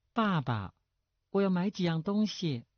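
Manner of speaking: put-on high, sweet-talking girlish voice; coquettish daughter. A narrator's reading. Daughter asking her father to buy things, coquettish. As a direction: wheedle like a pampered daughter. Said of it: "娇声说".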